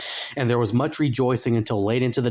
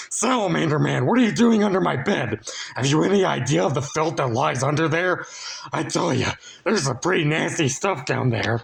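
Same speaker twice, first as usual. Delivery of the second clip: higher, mock-disgusted scolding; mild scolding; 5 semitones higher than usual. A narrator's reading. Frank teases the salamander, mock disgust. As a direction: tease him about the filth.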